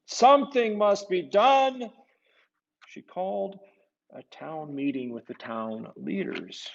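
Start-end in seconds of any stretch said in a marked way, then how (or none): 0.1-1.9 high, loud, urgent noblewoman's voice; frantic Contessa. Acted. Contessa urging the town leaders, high and loud. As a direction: insist on action in a shrill high pitch. Contessa continues her alarm.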